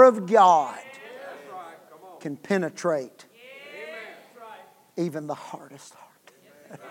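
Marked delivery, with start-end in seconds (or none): 5.0-6.8 hushed whisper for effect; a soft preacher's whisper; whispered point. Acted. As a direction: drop to a whisper to drive it home. Sermon point dropped to whisper.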